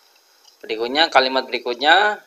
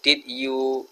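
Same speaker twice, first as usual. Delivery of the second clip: usual speaking voice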